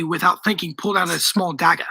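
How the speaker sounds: ordinary delivery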